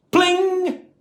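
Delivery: high ringing pling; ding it like a bell; pling sound effect. A narrator's reading.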